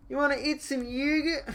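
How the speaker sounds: high silly voice asking about yogurt; silly Italian voice. Pretend. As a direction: squeak it like a cartoon Italian.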